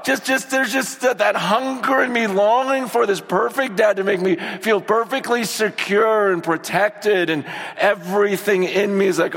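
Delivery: ordinary delivery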